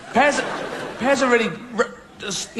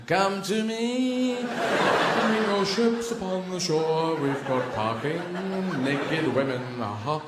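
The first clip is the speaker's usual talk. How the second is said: Greek siren; much slower than usual. Acted. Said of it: "That's the Greek siren".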